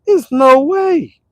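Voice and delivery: high, slow, stretched out; emphatic. Real, not acted. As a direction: draw it out in delighted disbelief.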